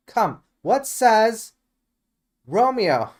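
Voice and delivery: high, loud, slow and pressing; eager pleading. Acted. Juliet pressing the nurse for Romeo's answer, eager and loud. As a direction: drag the question out, desperate to know.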